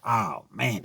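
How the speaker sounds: ordinary talk, nothing marked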